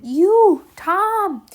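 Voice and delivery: high, loud shout across the garden; shouted call. Acted. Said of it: "lifted up her voice ... and shouted".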